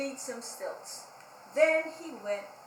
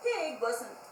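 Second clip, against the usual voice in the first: plain reading voice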